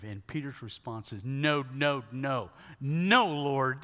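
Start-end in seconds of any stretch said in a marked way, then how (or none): 1.2-3.8 louder, insistent repeated no; loud protest. Acted. Peter protesting to Jesus, loud and insistent. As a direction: refuse it loudly, no after no.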